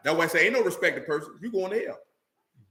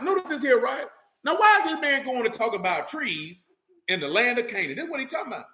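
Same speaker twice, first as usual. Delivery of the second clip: ordinary delivery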